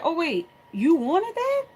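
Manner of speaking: high, drawn-out fake surprise; mock innocent. Mom playing innocent to her daughter.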